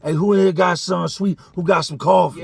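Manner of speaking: loud, high demanding call; yelling around. Retold or imitated. Trap demanding sweets from the cell door, loud yell. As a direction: bark it across the pod. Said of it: "starts yelling around".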